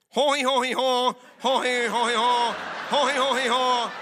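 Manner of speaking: loud mocking fake French babble; mock French gibberish. Mocking French to the crowd in gibberish. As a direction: parody French as silly noise. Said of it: "Now hear it in French".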